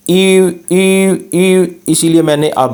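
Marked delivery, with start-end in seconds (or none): 0.1-1.1 ई said high and thin, lips rounded; thin long ü. Shown as a demonstration. Teacher modelling the fine ü vowel, high and thin. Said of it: "इस यू को हम बारीक पढ़ते हैं".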